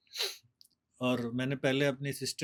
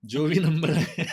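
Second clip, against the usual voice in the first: laughing; 8 dB louder, slower than usual. Real, not acted. Laughing while quoting the teacher.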